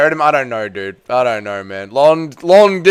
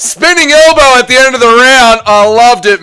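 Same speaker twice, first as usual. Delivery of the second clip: much higher, louder burst of delight; excited yell; 7 semitones higher, 7 dB louder than usual. Real, not acted. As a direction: shout it in sheer delight. Reacting to a spinning elbow.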